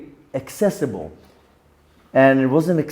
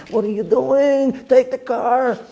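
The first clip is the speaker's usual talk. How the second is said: higher, slower urging from the evil inclination; pushier inner tempter; 8 semitones higher, slower than usual. Acted. His Yetzer Hara urging him toward the BMW, higher and insistent. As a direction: press him hard to take the car. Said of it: "my Yetzer Hara".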